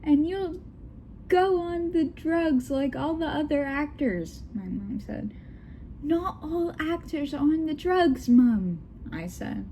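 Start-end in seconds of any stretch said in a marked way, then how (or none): 0.0-4.4 mock yelling parent. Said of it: "My dad yelled". 6.0-8.8 high-pitched British daughter; British accent. Acted. Daughter corrects her mom in a high British accent. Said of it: "her British Kate".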